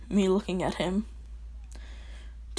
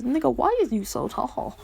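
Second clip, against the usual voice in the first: higher-pitched, swooping imitation of her disbelief; mock amazed question; 4 semitones higher than usual. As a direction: play her gawking at his height. Acted quote of amazed girl.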